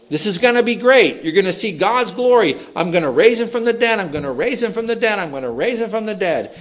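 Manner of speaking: high, insistent, repeated assurance; emphatic promise. Acted. Jesus insisting to doubting mourners, high and emphatic. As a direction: drive the promise home, pitched well up.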